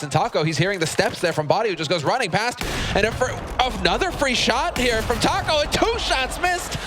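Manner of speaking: rising excited esports call; play-by-play. Real, not acted. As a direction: build the call up to the miss.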